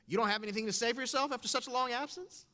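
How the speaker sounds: high, loud mocking jab; sneering taunt. Teacher taunting a returning student, loud and sneering. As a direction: needle the kid for being away.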